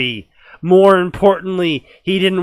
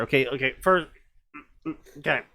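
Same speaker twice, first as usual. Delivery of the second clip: ordinary delivery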